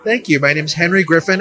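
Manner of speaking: ordinary talk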